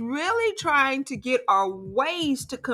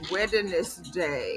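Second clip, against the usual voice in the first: echoed phrase repeats; 4 semitones lower, 4 dB quieter, much slower than usual. Real, not acted. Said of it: "I can hear myself twice".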